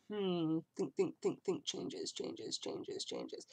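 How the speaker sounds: whispered musing chant, fading to breath; hushed hammed-up thinking aloud; mock pondering. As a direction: tease a vlogger straining to spot changes. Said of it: "the person's like".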